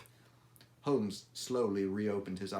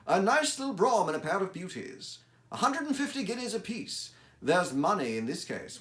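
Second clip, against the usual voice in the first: higher and louder, relishing the clue; Holmes voice; 9 semitones higher, 6 dB louder than usual. Acted. Holmes appraises the client's carriage, eager and higher. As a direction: savour the rich carriage like a gleeful sleuth.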